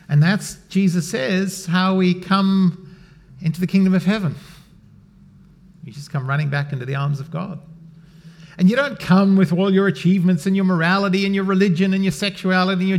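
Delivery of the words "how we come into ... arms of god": ordinary talk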